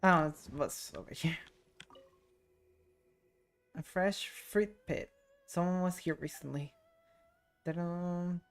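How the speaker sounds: ordinary talk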